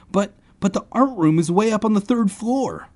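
high, stammered protest; sputtering. A narrator's reading. Boy objecting to his friend's plan, sputtering. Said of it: "I sputtered".

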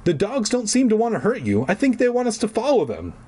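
lightly voiced father, reassuring; a hint of a calm dad; dad's voice. A narrator's reading. Dad reassuring the kids about the dogs, lightly voiced.

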